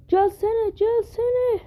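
high pleading whine; impatient high-pitched coaxing. Real, not acted. Pleading with the game to load.

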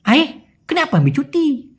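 high-pitched, slurred Malaysian slang; Malaysian slang drawl. Retold or imitated. Senior questioning worker's leave, in Malaysian drawl. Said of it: "ওদের স্ল্যাংয়ে বা ওদের টানে".

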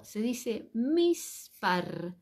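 mispar spoken very slowly, syllable by syllable; teacher dictates mispar to the class, very slow; slow pronunciation demo. Shown as a demonstration. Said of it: "Cómo se dice número en hebreo".

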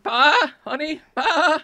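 high, bleating goat voice; mountain goat wife. Acted. Goat wife calls to her climber, high bleating. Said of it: "My mountain goat wife is up there".

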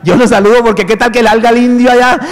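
usual speaking voice

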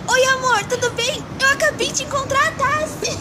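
high, bubbly rush of news; excited phone call. Retold or imitated. Daughter calling her mom, bursting with excitement. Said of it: "gritei para o mundo inteiro".

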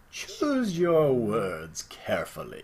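very slow, put-on English accent; mock English accent. Host reading the last option in a mock English accent. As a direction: drag it out, prim and English. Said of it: "I said the English accent".

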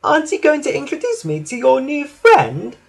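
sniffy, high-pitched question; Elizabeth. A narrator's reading. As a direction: ask it high and haughtily. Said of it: "sniffed Elizabeth".

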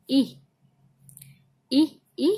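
"ई" as short i, like igloo; short I sound. Shown as a demonstration. Teacher showing the short I, "ई" said briefly. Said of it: "छोटी ई".

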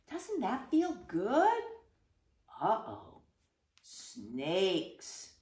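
plain reading voice

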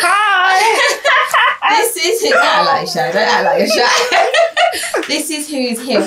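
high giggly teasing intro; laughing. Real, not acted. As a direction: laugh while coaxing a shy friend. Laughing introduction of shy guest.

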